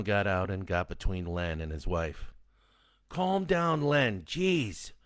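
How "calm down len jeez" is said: high, pleading man's voice; husband pleading with Len to calm down. A narrator's reading. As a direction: beg him to calm down. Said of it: "he pleaded".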